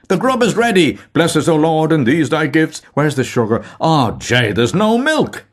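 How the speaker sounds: loud calling. A narrator's reading. Mulligan calling his messmates loudly to breakfast. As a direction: sing out the call to come and eat.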